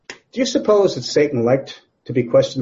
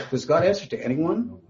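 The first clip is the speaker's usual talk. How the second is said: ordinary talk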